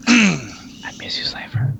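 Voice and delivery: breathy teasing whisper to an absent friend; mock whisper. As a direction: whisper it like a lovesick joke.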